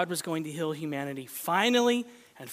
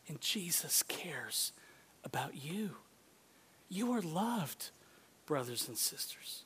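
whisper; 3 semitones lower, 11 dB quieter, slower than usual. Real, not acted. Sermon point whispered.

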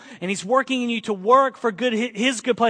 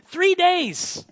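ordinary talk, nothing marked

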